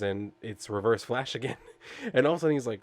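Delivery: usual speaking voice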